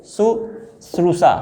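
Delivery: शुश्रूषा spelled out slowly, each syllable stretched; slow dictation. Shown as a demonstration. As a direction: drag शुश्रूषा out for the class to write. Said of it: "एक और बार लिखिए इसको".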